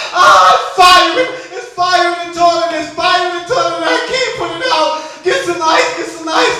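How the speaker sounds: high, loud hammed-up wail; panicked shouting. Man yelling to his woman from the toilet, mock panic.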